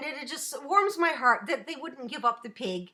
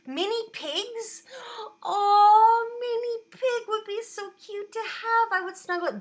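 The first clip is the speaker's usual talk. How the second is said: gushing; 8 semitones higher, 5 dB louder than usual. Real, not acted. Gushing over mini pigs.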